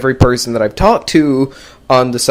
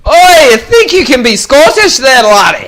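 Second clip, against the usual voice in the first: high, loud hammed-up Scottish accent; mock Scottish accent; 11 semitones higher, 6 dB louder than usual. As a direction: ham up a Scottish tough guy. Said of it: "funny accent".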